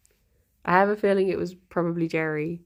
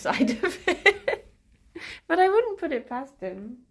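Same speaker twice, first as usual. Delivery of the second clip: usual speaking voice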